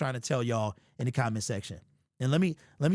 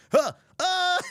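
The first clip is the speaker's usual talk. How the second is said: high hammed-up gasp of pain; mock gasp; 4 dB louder than usual. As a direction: gasp like you just fell. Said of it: "he could have been like".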